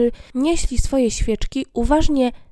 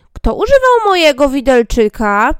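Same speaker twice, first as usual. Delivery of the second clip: loud odd little dwarf; 3 semitones higher, 10 dB louder than usual. Acted. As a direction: bellow it like a cross tiny man. Fifth dwarf asks. (głośny krasnoludek)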